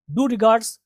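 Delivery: डू as "doo", without a y-glide; rejected pronunciation. Shown as a demonstration. Teacher telling students not to read so, doo pronunciation. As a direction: say डू regards with a plain "doo". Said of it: "नहीं पढ़ेंगे आप".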